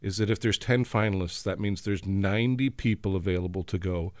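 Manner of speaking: usual speaking voice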